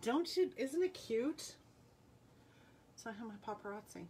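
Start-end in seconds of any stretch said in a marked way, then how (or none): 0.0-1.5 excited gushing. Real, not acted. Gushing over the necklace.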